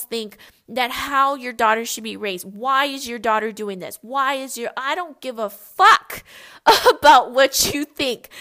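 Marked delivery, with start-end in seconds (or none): 4.8-8.3 loud, defiant, laughing through it; defiant outburst. Real, not acted. Telling judgmental in-laws off with a loud laughing outburst. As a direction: blurt it out to the critics, half laughing.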